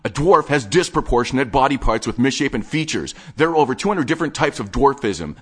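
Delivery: high, loud offended correction; indignant lecture. Acted. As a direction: correct him like an insulted expert.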